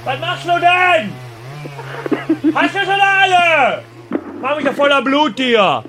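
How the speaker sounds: hammed-up alarmed shout; mock shout at brother. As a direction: yell over at the brother as if he's splattering blood everywhere. Prank caller yelling at butchering brother.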